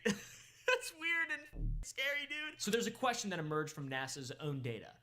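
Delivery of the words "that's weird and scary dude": high, drawn-out laugh through the words; amused, voice lifting high with laughter. Real, not acted. Host joking that the moon is scary, laughing.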